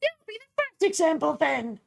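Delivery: high, menacing warden. Acted. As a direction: squeak out the threat up high. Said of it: "and say".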